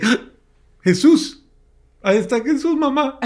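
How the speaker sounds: put-on a young woman shrieks at the picture, half laughing; excited shriek. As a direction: burst out with a startled laughing cry. Partying daughter sees Jesus.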